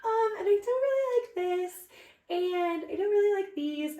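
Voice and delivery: high, strained-polite objection. Retold or imitated. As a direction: stay way up high, hesitantly turning things down. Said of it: "my voice just kept getting".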